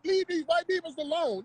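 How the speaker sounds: mocking imitation. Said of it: "talking about".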